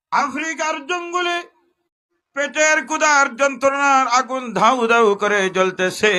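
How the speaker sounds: high, loud sermon chant; full-force preaching. Acted. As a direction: preach the servant's hunger to the crowd. Servant's ordeal preached at full force. (পূর্ণ জোরে ওয়াজ)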